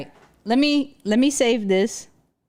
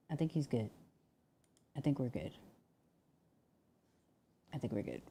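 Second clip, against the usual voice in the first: much softer, almost under breath; hushed; 9 semitones lower, 18 dB quieter, faster than usual. Real, not acted. Streamer settles his dog, speaking much softer. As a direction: murmur so the dog stays calm.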